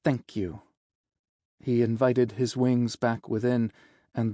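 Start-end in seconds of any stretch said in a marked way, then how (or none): 0.0-0.6 reluctant, slightly lowered thank-you; stiff thanks. Acted. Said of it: "The following he said stiffer".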